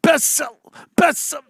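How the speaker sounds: high, tripping over the words; stumbling excitedly. Real, not acted.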